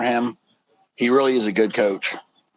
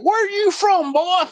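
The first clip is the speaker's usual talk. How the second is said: high mock Texas twang, a bit louder; country Texas accent; 4 dB louder, slower than usual. Country guy asks the stranger where he's from in a Texas accent. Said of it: "He's like".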